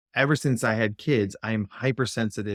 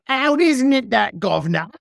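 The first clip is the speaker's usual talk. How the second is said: put-on grating Cockney accent; 11 semitones higher, 5 dB louder than usual. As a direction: imitate an angry coach in exaggerated Cockney. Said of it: "grating Cockney British accent".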